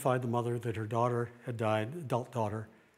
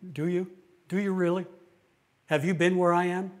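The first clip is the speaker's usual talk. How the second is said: sharp, louder challenge pushing back; indignant retort; 5 semitones higher, 6 dB louder than usual. Retold or imitated. Grieving person snapping back at a comforter, indignant. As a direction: throw the question back, offended. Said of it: "sometimes you get a reaction".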